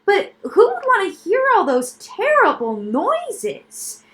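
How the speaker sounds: put-on high, slow, appalled child's voice; horrified boy. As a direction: recoil at the very idea. Milo horrified question.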